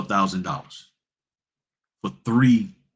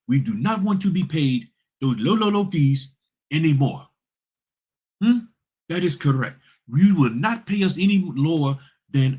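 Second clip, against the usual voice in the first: mock an African accent, much higher and firm; 7 semitones higher than usual. Notary voicing demands to the signing company in an African accent. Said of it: "no, I'm gonna do African".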